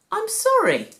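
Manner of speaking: put-on a boy saying sorry. A narrator's reading. Jesus apologises to his worried mother, sincerely. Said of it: "Jesus said".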